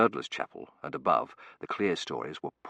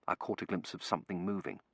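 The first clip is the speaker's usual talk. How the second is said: ordinary delivery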